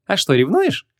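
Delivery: playful, teasing rise on ревнуешь; coquettish tease. A narrator's reading. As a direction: flirt with him, teasing. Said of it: "кокетливо стрельнула глазками Вон". (кокетливо, с поддразниванием)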